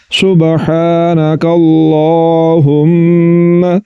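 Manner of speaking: slow, careful Quranic recitation. Shown as a demonstration. Teacher reciting the joined phrase for students. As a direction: recite it measured and clear. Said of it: "अब इसको पढ़ते हैं".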